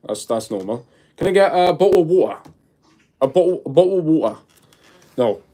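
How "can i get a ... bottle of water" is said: higher British "bottle of water", dropped T; mock British accent. Shown as a demonstration. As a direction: clip the T in "bottle" and "water", cockney style. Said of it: "Is the accent a bit different?".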